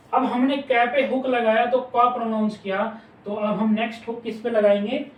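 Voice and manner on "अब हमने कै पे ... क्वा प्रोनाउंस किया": usual speaking voice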